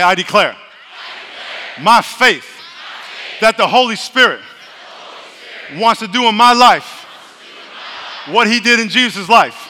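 loud slow preached declaration; shouted, drawn-out creed; like we mean it. Real, not acted. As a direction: declare it with full conviction. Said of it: "Let's say this like we mean it".

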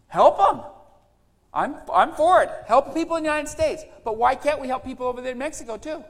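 high, loud preacher's cry; full-force preaching. Acted. Pastor exhorting the church, loud high preaching. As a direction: preach it out with fire.

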